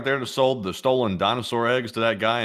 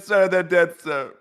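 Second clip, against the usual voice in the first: lightly voiced aide, higher; a hint of a worried aide; mock subordinate reporting bad news; 6 semitones higher, 3 dB louder, slower than usual. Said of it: "He said".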